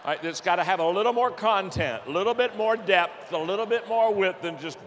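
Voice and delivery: preacher pumping up the story of his panic; lively preaching. Acted. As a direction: drive it home with rising energy. Preacher stirring crowd with panic story.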